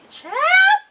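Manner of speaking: very high, loud squeal of disbelief; exasperated outburst. Real, not acted. Reader fed up with the long letter, exasperated outburst. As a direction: break off and squeal it out.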